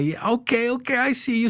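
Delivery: high-pitched teasing hype; hyping someone up. Retold or imitated. Sister giving a pat on the back, hyping up. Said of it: "she's hyping me up".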